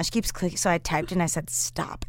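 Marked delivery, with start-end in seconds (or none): 1.5-2.0 understated, softly spoken stop; quiet retort. Retold or imitated. Co-host retells typing to her meddling friend, quiet retort. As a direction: say it small and low. Typed argument, first calm round.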